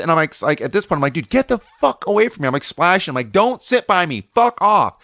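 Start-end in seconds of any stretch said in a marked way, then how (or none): 1.1-2.5 high, indignant re-enacted brush-off; pissed. Surfer splashing the guy who follows, pissed. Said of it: "like, I'm pissed". 3.3-5.0 re-enacted curt rejection, higher pitched; pissed. Surfer refusing company in the lineup, pissed. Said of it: "like, I'm pissed".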